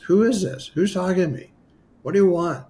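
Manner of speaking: high-pitched, puzzled questioning; baffled demand. Retold or imitated. Man questioning a voice he can't see, baffled demand. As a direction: act out challenging an unknown caller.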